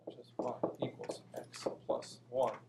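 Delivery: whispering. Real, not acted. Teacher whispers while explaining.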